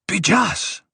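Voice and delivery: high-pitched snap at someone; snapped. A narrator's reading. Duri rebukes the dwarf, snapping. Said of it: "Duri snapped".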